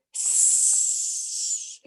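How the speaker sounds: long spooky voiceless hiss; horrible hiss. A narrator's reading. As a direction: hiss like a graveyard monster. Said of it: "a horrible hissing sound".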